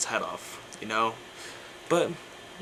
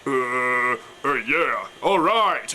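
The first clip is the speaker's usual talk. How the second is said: death metal growl; much slower than usual. Shown as a demonstration. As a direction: growl it from the chest like a gorilla. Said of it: "but it's more like".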